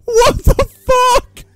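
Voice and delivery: very high, slow, loud screech; panicked high-pitched scream; shrieked disbelief. Real, not acted. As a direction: screech it out in shock. Screaming after attack in game.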